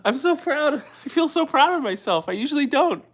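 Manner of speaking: holding back happy tears; moved and proud, voice up high; emotional pride. Real, not acted. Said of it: "I'm getting emotional".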